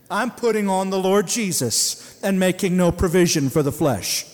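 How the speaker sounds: loud preacher's declaration, driving it home; loud proclamation; full-force preaching. Acted. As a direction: proclaim it to the congregation.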